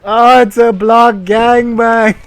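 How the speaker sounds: loud, high, slow disbelieving shout; shocked shout. Real, not acted. Streamer shouts at the absurd story, loud and slow. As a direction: bellow it out in disbelief. Shocked reaction while reading.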